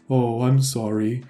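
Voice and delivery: put-on slow, forlorn Welsh kid apology; lonely Welsh voice. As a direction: say it small and lonely, with a Welsh lilt. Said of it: "this really lonely Welsh voice just go".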